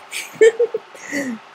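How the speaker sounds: put-on high girlish giggle. Twilight giggling at Fluttershy's story. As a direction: giggle helplessly.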